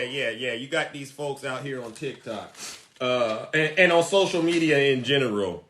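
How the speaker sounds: ordinary talk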